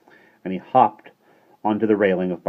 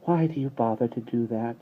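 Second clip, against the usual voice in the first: soft amused voice; 5 semitones higher than usual. A narrator's reading. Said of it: "in her soft, amused voice".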